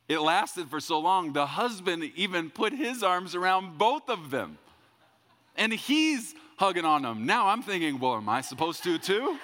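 high, loud, worked-up storytelling; comic bewilderment. Retold or imitated. Preacher recounting an awkward group hug, comically bewildered. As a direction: pitch it way up in mock helplessness.

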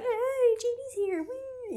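put-on excited party girl. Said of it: "they're like".

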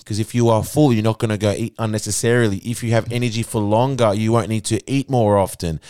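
ordinary talk, nothing marked

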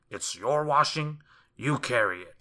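loud, emphatic brush-off; rude refusal. A narrator's reading. Mister Mean refusing the washerwoman, loud and rude. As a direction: refuse loudly, hitting the words hard.

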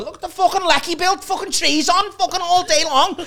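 loud, high-pitched broad Scouse; Scouse accent. Retold or imitated. Showing off a Christmas video, loud Scouse accent. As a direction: rant in hammed-up Scouse. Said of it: "Scouse where I".

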